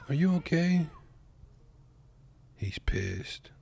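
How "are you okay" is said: high, louder mock-concerned lady voice; hammed-up imitation of the lady asking. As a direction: squeak it out like the worried lady. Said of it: "The lady's gonna be like".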